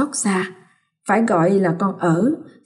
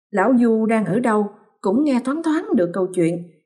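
plain reading voice

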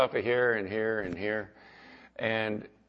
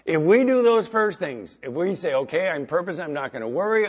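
louder, higher preaching voice, building; fervent preaching; 5 semitones higher, 5 dB louder than usual. Acted. Preaching at full force.

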